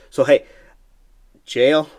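sudden loud high "jail?"; startled outburst; surprised. Pretend. Mock surprised reaction to comment.

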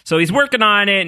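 ordinary delivery

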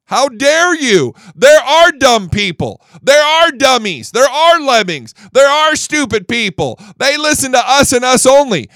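loud, high, indignant mock rant; mock outrage. Mocking the left, he rants in loud mock outrage. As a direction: ham up the left's fury at the crowd.

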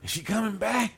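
eager fans calling out for Charlie Bell; quoting fans. Retold or imitated. Fans asking after Charlie Bell, high and eager. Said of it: "They was like".

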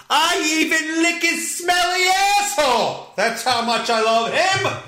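high, fervent shouted declaration; hammed-up fanatical gushing; over-the-top devotion. Over-the-top mock devotion rant.

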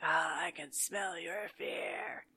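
slow, drawn-out spooky villain voice; mock-creepy slow menace; menacing villain. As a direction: draw it out like a ghoul savouring the hunt. Acting the menacing Zeke the plumber.

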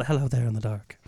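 low, eerie greeting; spooky voice. Pretend.

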